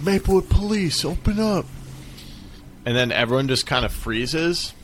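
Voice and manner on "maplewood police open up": high mock cop voice; police officer shout. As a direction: imitate a cop at the door. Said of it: "they're like".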